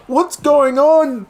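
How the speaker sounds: very high, loud, drawn-out question; bewildered shout. Retold or imitated. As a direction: wail it out in mock panic. His bewildered reaction.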